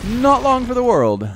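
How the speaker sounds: slow, high mock British accent, drawn out; a hammed-up British accent; playful bad British accent. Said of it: "Sorry if I have a bit of a British accent today".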